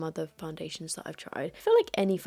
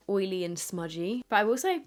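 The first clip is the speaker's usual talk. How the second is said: normal talking voice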